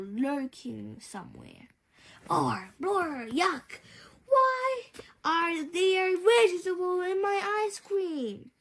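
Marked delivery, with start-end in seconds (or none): 2.3-8.5 put-on higher, pitched-up yuck of a kid; boy discovering broccoli in his dessert, lightly voiced disgust. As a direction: gag at the ice cream like a grossed-out child. Boy finds vegetables in ice cream.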